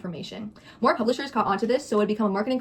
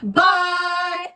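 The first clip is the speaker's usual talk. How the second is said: shouted goodbye; 8 semitones higher, 5 dB louder than usual. Real, not acted. Calling out farewell at the end.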